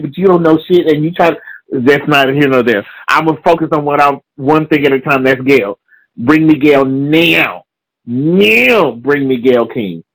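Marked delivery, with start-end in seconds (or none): 6.1-10.0 slow demand. Real, not acted.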